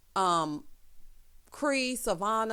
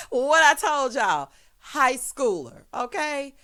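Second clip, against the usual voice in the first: drawn-out, louder and higher scolding; loud told-you-so; 5 semitones higher, 7 dB louder, slower than usual. Real, not acted. Told viewers they act like high schoolers, loud drawn-out scolding. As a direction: stretch it out, loudly vindicated. Vindicated scolding after her laugh.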